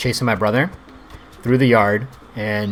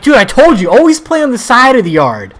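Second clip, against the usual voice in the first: higher, louder exasperated yell; dad scolding; 13 semitones higher, 11 dB louder than usual. Retold or imitated. Dad yelling at his son to play by the side, angry yell. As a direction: shout it like a dad dodging the blame. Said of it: "my dad's like".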